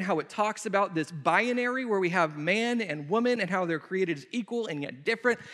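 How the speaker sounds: usual speaking voice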